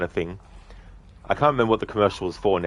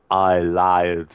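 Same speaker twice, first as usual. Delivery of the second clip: Arnie impression; 2 semitones lower, 5 dB louder than usual. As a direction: drop it flat like Arnie. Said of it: "Arnie movie scene impression".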